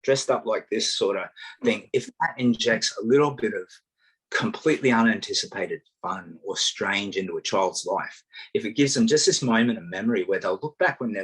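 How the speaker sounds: ordinary delivery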